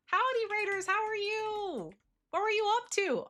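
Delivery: high chirpy greeting; perky high streamer hello; cute anime streamer. Acted. Greeting raiders.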